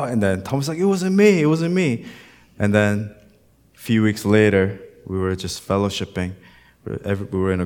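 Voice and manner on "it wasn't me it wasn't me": put-on higher, louder young guy protesting; mock protest. Pastor voices Thomas denying the fart, high mock protest. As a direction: whine the denial like the kid.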